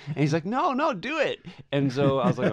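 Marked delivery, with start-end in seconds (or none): 0.5-1.4 urging stranger voice. Retold or imitated.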